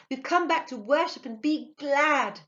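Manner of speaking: jubilant, ringing call; merry cry. A narrator's reading. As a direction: cry it out in delight. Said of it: "she cried merrily".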